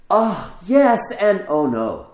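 a high, loud burst of joy that drops into worry; joyous yet frightened. Retold or imitated. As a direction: gasp with delight, then catch yourself in fear. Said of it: "the disciples are joyous and frightened".

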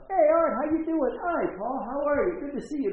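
high, loud, excited acted hellos; hearty greeting. Pastor comes out to greet Art, acted hearty welcome. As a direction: play a jolly reunion, both sides.

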